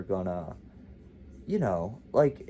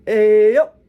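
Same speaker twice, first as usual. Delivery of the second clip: loud, high drawn-out holler; junkie's street call; 11 semitones higher, 16 dB louder than usual. Retold or imitated. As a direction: imitate a street call to passing cars, cut off sharply at the end. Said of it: "this kid did that".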